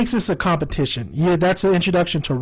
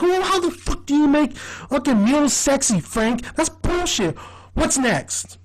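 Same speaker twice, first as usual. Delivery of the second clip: indignant, higher-pitched protest; Dennis; 7 semitones higher than usual. Retold or imitated. Dennis objects to Frank judging his mule pose, indignant and higher. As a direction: complain in a raised, affronted pitch. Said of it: "And Dennis like".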